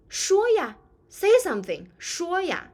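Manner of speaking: high, loud pressing 说呀; urgent. Shown as a demonstration. Teacher plays someone pressing a silent friend to speak, urgent. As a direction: push them to speak now. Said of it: "can sometimes convey urgency".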